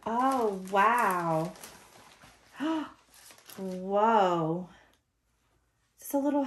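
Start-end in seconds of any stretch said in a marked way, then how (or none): none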